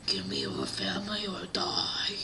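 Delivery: soft, whispered villain threat; Lord Voldemort. Acted. Villain demands the family from the man, whispered threat. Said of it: "Lord Voldemort goes to the man".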